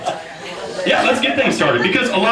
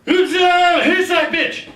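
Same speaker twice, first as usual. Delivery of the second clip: mock drunk shout; 8 semitones higher, slower than usual. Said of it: "drunkenly just shouted out".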